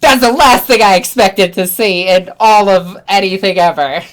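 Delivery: loud, thrilled disbelief; excited clerk. Retold or imitated. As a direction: gush like it made his whole day. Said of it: "And he's like".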